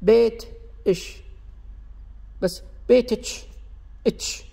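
بيت then a pause then إش; unnatural, cut-apart بيت إتش; separated t and sh. Shown as a demonstration. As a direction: split بيت from إتش on purpose. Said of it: "راح يكون غير طبيعي".

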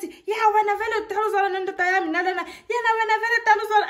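high, loud, fast angry rant; furious quarrel. Retold or imitated. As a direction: rattle it off fast, spoiling for a fight. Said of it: "now they want to start fighting".

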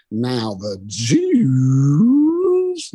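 lightly imitated comedian's slow setup; long comic pause. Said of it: "you know, hear a pause".

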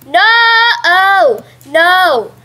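loud, high, drawn-out protest; dismayed cry. Real, not acted. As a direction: wail out in alarm mid-game. Dismayed cries during an attack.

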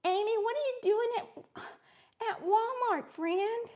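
bright, high playful hello; excited greeting. Real, not acted. Crafter greeting a viewer who is at Walmart, high and excited. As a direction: call out to a friend, delighted.

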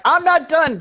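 high, loud defiant horse voice. Retold or imitated. Mare defiantly answering the communicator, high and loud. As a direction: talk back like a stubborn mare. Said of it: "She's like".

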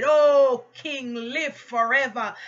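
big loud "Oh", then slowing; hailing cry that settles into a slow proclamation; retold shout back. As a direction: call back to the king, imitating a shout. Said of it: "Daniel shouted back and said".

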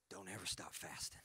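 very low, soft, solemn charge; grave fatherly command, said quietly; imitating warrior dad. Warrior dad charges his son about fasting, imitated solemn command. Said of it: "you know what he said?".

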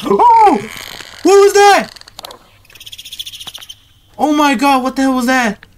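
sudden high frightened cry; startled shriek. Real, not acted. Jump scare in horror game.

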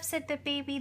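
ordinary delivery, nothing marked

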